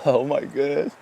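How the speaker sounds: choked up, apologising through tears; real crying. Real, not acted. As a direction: get it out between tearful breaths. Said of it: "I'm sorry for crying".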